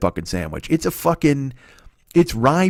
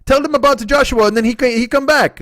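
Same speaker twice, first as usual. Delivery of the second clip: put-on high, eager accented old deli man; high eager voice of the old deli owner pushing a huge sandwich; 13 semitones higher, 4 dB louder than usual. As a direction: gush like a proud old shopkeeper. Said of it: "And he goes".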